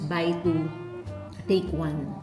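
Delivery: normal talking voice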